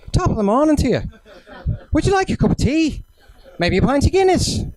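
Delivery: high, lilting, badly faked Irish brogue; bad Irish accent. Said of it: "That's the worst Irish accent I've ever heard".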